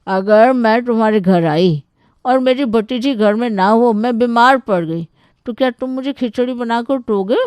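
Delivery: high, loud aunt putting him to the test; interrogating aunt. Acted. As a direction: grill the suitor as a demanding aunt. (सवाल दागती बुआ की ऊँची आवाज़)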